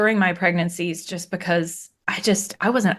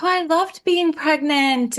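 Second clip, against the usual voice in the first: mock gushing; 8 semitones higher than usual. Mocking people gushing about pregnancy.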